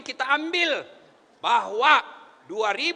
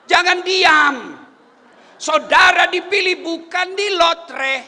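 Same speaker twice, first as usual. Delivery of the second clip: fiery preaching; 3 semitones higher, 7 dB louder, slower than usual. Real, not acted. Preacher urging elected representatives to speak up, fiery preaching. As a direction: hammer each phrase out slowly at full force.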